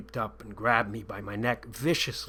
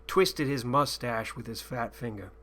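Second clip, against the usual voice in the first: ordinary delivery, nothing marked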